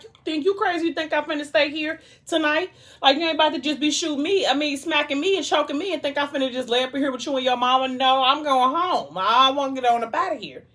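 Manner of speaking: high-pitched, incredulous retort; indignant refusal. Retold or imitated. Morgan refusing to stay with him, high indignant protest. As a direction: act out an outraged refusal, pitched well up.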